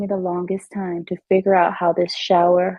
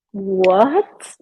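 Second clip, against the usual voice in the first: mock shock. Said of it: "Like heads on a swivel".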